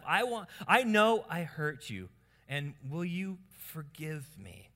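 slow, emphatic plea for forgiveness; deliberate model apology. Shown as a demonstration. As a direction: spell it out for the learners. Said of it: "Start saying these things".

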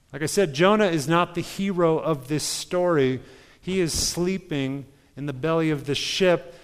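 normal talking voice

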